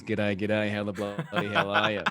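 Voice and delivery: playfully over-the-top Aussie greeting; broad Australian accent, played up a little; exaggerated Australian. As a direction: lay on the Australian accent for emphasis. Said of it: "Did you go the most Australian there".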